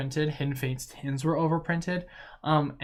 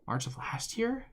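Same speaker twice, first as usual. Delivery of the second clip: surprised question; 10 semitones higher, 4 dB quieter than usual. Real, not acted. Double-checking the release date.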